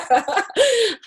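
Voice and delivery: high teary laugh; emotional laugh. Real, not acted. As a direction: burst into a tearful laugh. Laughs at her own vulnerability.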